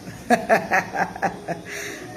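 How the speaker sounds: long, quiet chuckle; laugh. Real, not acted. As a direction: laugh softly under the breath. Laughs after own remark.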